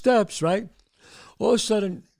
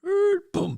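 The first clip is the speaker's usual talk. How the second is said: high creaky squeal, then a boom; door creak imitation. Storyteller mimics the basement door opening, creak and boom. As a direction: mimic a door swinging open and banging. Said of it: "we hear the door to the basement open".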